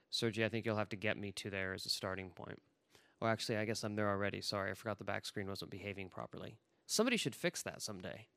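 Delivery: quick, low, quiet aside; murmured housekeeping aside to a helper; offhand aside. Real, not acted. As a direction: drop the voice and hurry through it. Aside to the screen operator.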